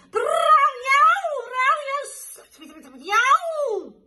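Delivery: very high, loud yowling; a caterwauling cat chorus. Acted. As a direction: yowl like fighting cats at night. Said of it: "caterwaul symphony".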